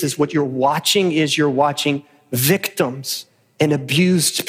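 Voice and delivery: low, measured sermon voice; solemn preacher's challenge; earnest preaching. Acted. Preacher confronting the congregation.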